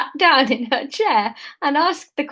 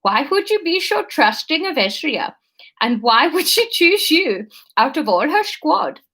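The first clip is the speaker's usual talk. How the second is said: put-on higher-pitched questioning in a Dutch accent; bad Dutch accent; 4 semitones higher than usual. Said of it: "integral to my Dutch accent".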